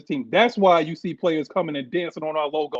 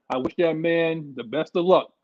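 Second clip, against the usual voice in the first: usual speaking voice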